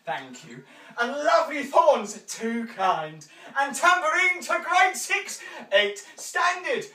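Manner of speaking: loud, high, showy auctioneer spiel; booming auction-house patter. Acted. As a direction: sell the rose to the room like a market auctioneer. Auctioneer holding the floor.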